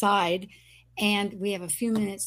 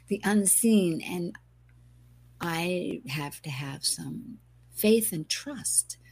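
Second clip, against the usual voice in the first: ordinary talk, nothing marked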